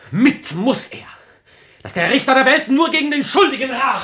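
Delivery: wild furious outburst; furious shout. Acted. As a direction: hurl the curse at the murderer. Ferdinand rages at his father.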